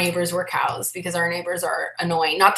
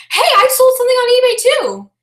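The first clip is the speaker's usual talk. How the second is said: high, loud, over-eager mimicry; awkward acquaintance; 7 dB louder than usual. Somebody relating to a reseller, mock-overexcited. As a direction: blare it out like a clueless enthusiast. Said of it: "Isn't that awkward? That's, like, the worst".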